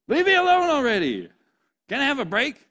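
put-on high, loud whiny kid complaint; hammed-up whining at a sibling. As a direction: squeal it like an annoyed kid sibling.

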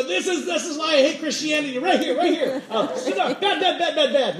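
high, rapid comic tirade; hammed-up scolding, pitched high; mock outraged rant. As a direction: rattle it off in exaggerated disgust. Acts out his disgusted younger self.

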